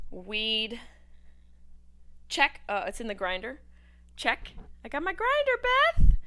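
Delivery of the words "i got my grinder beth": much higher and louder, calling out to Beth; excited call-out. Real, not acted. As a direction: shout it out proudly.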